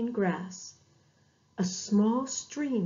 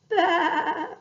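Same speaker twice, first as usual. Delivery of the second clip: lamb's bleat; 7 semitones higher, 6 dB louder than usual. Acted. Lost lamb calling.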